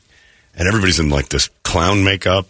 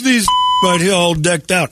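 high-pitched imitation of a baffled fan; mock incredulous; 10 semitones higher than usual. Retold fan pointing people out.

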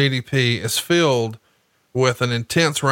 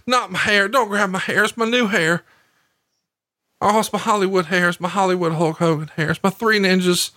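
high pleading whine over the hair, a good bit higher; hammed-up frightened pleading, much higher; mock panic; 6 semitones higher than usual. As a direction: squeal it like a wrestler selling in fake terror. Voicing wrestler pleading over hair.

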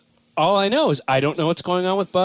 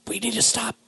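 Obama whisper; 4 semitones higher, 6 dB quieter than usual. Retold or imitated. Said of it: "he goes into the whisper".

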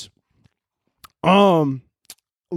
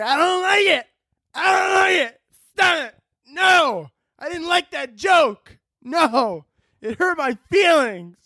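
very high-pitched mock whine; shrill mocking wail, words drawn out; whining complainer; 13 semitones higher, slower than usual. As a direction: throw a hammed-up tantrum like an offended crybaby. Mocks an offended person reporting.

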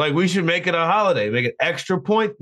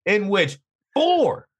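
excited; 8 semitones higher than usual. Real, not acted. Hyping the network's big win.

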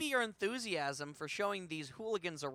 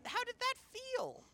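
high gentle question; slower than usual. Acted. Talking to ghost child.

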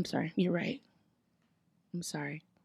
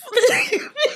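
high laugh; 3 dB louder than usual. Real, not acted. Laughs after line.

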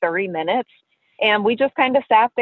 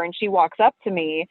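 ordinary talk, nothing marked

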